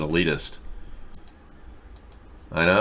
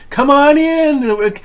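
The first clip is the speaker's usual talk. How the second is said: much higher, louder sing-song mock voice; mock naive gun owner; 8 dB louder than usual. Host parodies a Democrat welcoming the police, in a high, loud mocking voice. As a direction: play a simpering homeowner handing over his guns. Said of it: "They would love it if they could just open their doors and".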